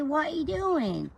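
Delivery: drawn out like cooing to a pet; baby voice. Pretend. Woman voices her pet in baby talk. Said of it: "baby voice to their pets".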